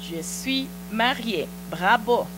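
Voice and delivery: usual speaking voice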